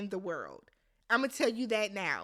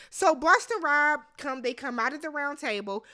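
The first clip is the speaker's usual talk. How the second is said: ordinary talk, nothing marked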